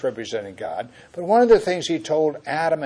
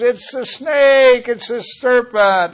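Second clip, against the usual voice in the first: whiny blame; 11 semitones higher, 10 dB louder, slower than usual. Acted. Eve blames the serpent.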